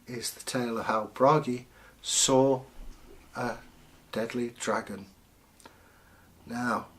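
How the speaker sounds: ordinary delivery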